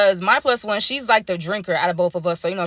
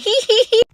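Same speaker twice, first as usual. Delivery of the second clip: mock giggle; 13 semitones higher, 6 dB louder than usual. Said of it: "Peyton roll her eyes like".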